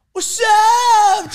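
high shouted greeting to startle friends; shouting down at friends as a fake cop. Jumping on fence to scare friends.

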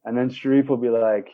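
ordinary talk, nothing marked

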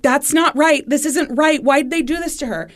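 high, distraught protest; retold sobbing. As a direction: plead it like a man overcome, without real tears. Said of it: "he's just sobbing".

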